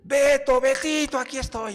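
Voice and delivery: loud high calling; mother searching for her lost boy, shouting. Retold or imitated. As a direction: shout his name across the hall. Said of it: "mi mamá siempre me dijo".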